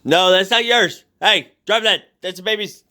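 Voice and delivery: high-pitched scolding of the puppy; scolding the dog. Real, not acted. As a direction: tell the dog off in a high stern tone. Scolding dog over baby's toy.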